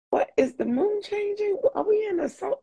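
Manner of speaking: high-pitched imitation of being baffled; mock bewilderment. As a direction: play it as a confused cry at the strange day. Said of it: "and you're like".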